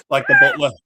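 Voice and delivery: high, loud excited comparison. Real, not acted. Friend excitedly likening dad to the film butler. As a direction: squeal it out delighted.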